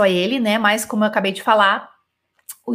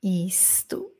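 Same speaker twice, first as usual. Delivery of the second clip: whisper; 4 semitones lower, 10 dB quieter than usual. Real, not acted. Whispered aside.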